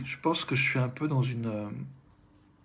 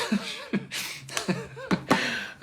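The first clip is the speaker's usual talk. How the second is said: a long, high, airy laugh of relief; high released laugh. Real, not acted. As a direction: burst into a breathy laugh, letting go. Laughs after saying she likes crying.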